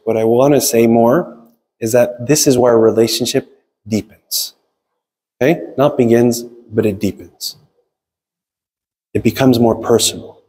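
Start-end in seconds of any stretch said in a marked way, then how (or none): none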